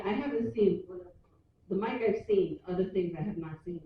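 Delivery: usual speaking voice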